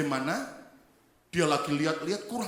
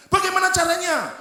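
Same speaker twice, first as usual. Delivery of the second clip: woman's question; 10 semitones higher, 7 dB louder than usual. Acted. Woman asking the rescuer.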